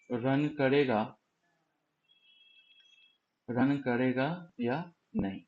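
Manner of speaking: slow, with long pauses between words; slow explaining. Shown as a demonstration. Teacher patiently repeating the point for students, slowly. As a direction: let each word land on its own. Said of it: "मैं फिर से एक बार समझाने की कोशिश".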